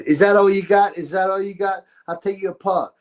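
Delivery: high, loud taunt of a female fighter; mock taunting boxer. Mimicking her taunting the prospect in the ring, loud and high. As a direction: jeer at him like a cocky fighter. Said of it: "And she goes".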